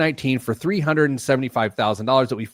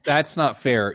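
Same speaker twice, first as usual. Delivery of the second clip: ordinary talk, nothing marked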